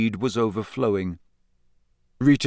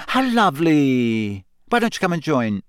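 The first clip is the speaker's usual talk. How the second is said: fake-sweet welcome; 3 semitones higher than usual. A narrator's reading. Rita greeting Harry with a beaming false welcome. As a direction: gush with a false smile.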